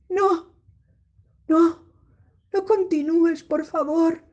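loud, scared begging; frightened pleading. Shown as a demonstration. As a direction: plead with it to stop. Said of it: "Mi cara palidece". (súplica asustada y fuerte)

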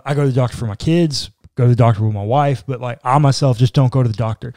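ordinary talk, nothing marked

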